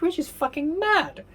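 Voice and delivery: sky-high disbelief over an eighty-dollar dish; outraged exclaim. Real, not acted. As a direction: let the outrage at the bill lift the voice high. Outraged at the restaurant prices.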